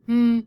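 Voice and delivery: loud closed-mouth hum in pushes of air; whale talk hum. Shown as a demonstration. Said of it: "So if I whale talk".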